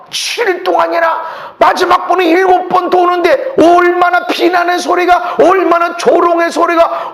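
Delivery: loud, high impassioned preaching; shouted preaching. Acted. Preacher describing the mocking at Jericho to the church, shouted preaching. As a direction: preach it full out, building.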